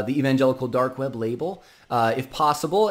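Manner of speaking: ordinary talk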